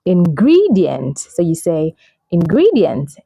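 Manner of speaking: "ingredient" as in-GREE-dee-ent; second-syllable stress. Shown as a demonstration. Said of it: "the stress is on the second syllable".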